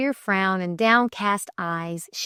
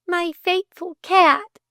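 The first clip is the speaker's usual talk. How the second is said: high, drawn-out fond lament; high-pitched self-consoling voice; girl's plaintive thought; 9 semitones higher, 4 dB louder, slower than usual. A narrator's reading. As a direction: dwell fondly on each word, high and slow. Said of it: "she thought".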